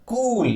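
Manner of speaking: कूल with the "oo" drawn out; stressed long vowel. Shown as a demonstration. Teacher demonstrating syllable stress, कूल pulled long. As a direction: stretch the vowel of कूल slowly. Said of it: "लंबा खींचा, ये इसे कहलाते हैं stress".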